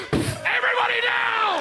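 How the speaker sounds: high, drawn-out yell; home invasion shout. Acted. Comic acting out a home invasion, shouted. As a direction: bellow like an intruder storming in. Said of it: "like a home invasion".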